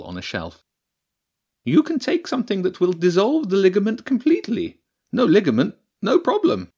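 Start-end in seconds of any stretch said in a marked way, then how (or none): 1.6-6.7 high, self-satisfied badger voice; pleased badger priestess. The badger priestess pleased with her own remedy, high and smug. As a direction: boast about your remedy, high and bright.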